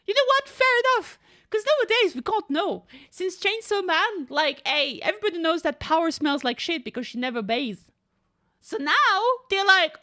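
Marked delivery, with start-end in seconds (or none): none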